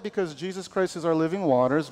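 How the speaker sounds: usual speaking voice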